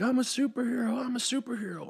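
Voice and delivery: high, sing-song, overjoyed; gleeful Polka-Dot Man. Retold or imitated. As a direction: crow it like a kid who won. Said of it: "he's like".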